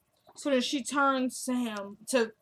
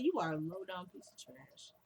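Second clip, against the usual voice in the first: much softer, cool and composed; classy put-down; 4 semitones lower, 10 dB quieter than usual. Retold or imitated. Veronica insulting Jennifer, soft and classy. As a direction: deliver the insult softly, with poise. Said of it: "she said it so classy".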